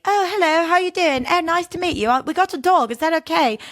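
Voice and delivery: imitating a chirpy, innocent-seeming host; average friendly couple. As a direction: sound harmless like a horror-movie killer couple. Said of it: "this very on paper, just, like, average".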